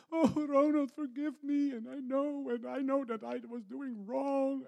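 high, loud weeping voice of the repentant lady; crying plea. Retold or imitated. Lady begs the pastor's forgiveness, crying high and loud. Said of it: "she started to cry".

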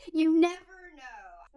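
slow, high YOU NEVER KNOW gliding down; drawn-out. Real, not acted. Repeats it drawn out for effect.

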